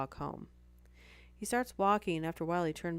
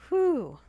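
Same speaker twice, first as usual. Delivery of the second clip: loud whew; 11 semitones higher, 11 dB louder than usual. Real, not acted. Relief after telling creepy story.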